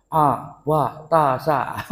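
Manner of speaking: Abatasa stretched out very slowly, syllable by syllable; teacher sounding out Abatasa for a slow pupil; slow drilled reading. Shown as a demonstration. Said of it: "abatasa ja".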